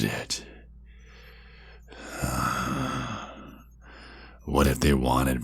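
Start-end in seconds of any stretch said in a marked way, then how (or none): 2.1-3.2 soft, drawn-out sigh; long sigh. Acted. Vampire taunting Red about being watched, long soft sigh. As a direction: let a long breath out softly. Savours the teasing idea.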